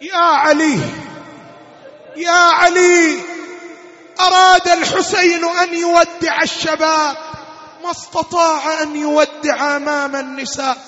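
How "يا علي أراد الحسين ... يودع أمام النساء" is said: ordinary talk, nothing marked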